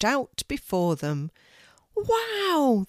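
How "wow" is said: high, falling wow; kids' thrilled wow; amazed shout. A narrator's reading. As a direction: let out a high cry of wonder. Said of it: "they shouted together".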